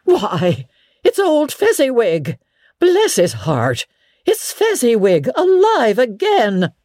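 high-pitched, thrilled exclamation; great excitement. Acted. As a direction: cry out in delighted recognition. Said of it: "Scrooge cried in great excitement".

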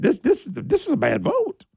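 ordinary delivery

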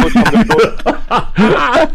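slow, high and loud, breaking into laughter; can't get the line out for laughing; giggling through the punchline. Retold or imitated. Punchline quote while cracking up.

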